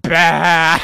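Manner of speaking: loud mock sheep baa; sheep bleat. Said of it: "And I said".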